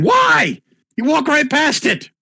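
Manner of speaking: very high put-on wail; high, drawn-out mock complaint; acted outcry. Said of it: "That's all saying".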